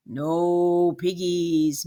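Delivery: slow, low mooing; mooing cow. Acted. Cow mooing its answer to the witch, slow and low. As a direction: stretch it out like a cow. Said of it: "mooed the cow".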